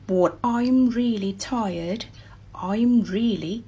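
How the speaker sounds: slow "I'm really tired" for learners; slow practice reading. Shown as a demonstration. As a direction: read it slowly and clearly. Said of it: "เอา really มาช่วย".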